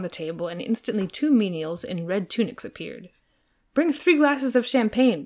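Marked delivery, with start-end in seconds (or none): 3.7-5.2 a royal order, higher and louder; mildly imperious prince; commanding. A narrator's reading. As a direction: give the servants a lordly command. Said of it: "commanded the prince".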